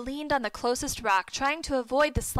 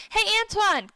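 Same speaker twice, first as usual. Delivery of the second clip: higher, louder hail to a friend; calling out; 9 semitones higher, 5 dB louder than usual. A narrator's reading. Michael hailing Antoine from behind, calling out. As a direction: call out to get his attention.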